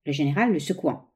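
ordinary delivery, nothing marked

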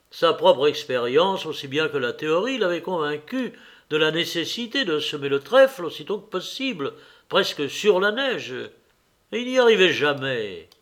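usual speaking voice

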